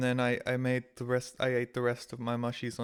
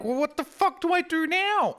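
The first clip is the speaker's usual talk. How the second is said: much higher, louder cry of frustration; exasperated despair; 6 dB louder than usual. Retold or imitated. Streamer stranded at the closed cafe, high exasperated cry. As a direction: throw the question up in despair.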